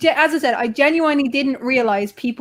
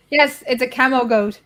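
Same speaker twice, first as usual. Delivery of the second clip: normal talking voice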